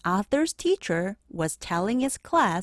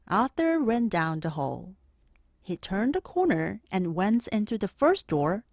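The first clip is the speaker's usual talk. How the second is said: usual speaking voice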